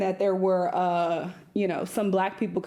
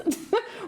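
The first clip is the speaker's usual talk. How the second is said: sudden high burst of laughter; short high laugh; 12 semitones higher than usual. Real, not acted. Laugh after outburst.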